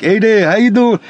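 East Coast greeting with a hint of cartoon lug; lightly put-on East Coast character. Shown as a demonstration. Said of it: "I was kinda lower".

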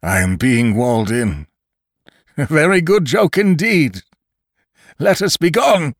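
dying man's wild screaming laughter. Acted. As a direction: shriek it out half mad with glee. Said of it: "A wild scream and much laughter".

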